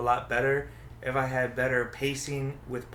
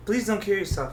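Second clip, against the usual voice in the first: high-pitched mock pleading; playful begging in a raised voice; joking plea; 7 semitones higher, 4 dB louder than usual. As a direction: plead with a friend, half in jest. Jokey plea to co-host.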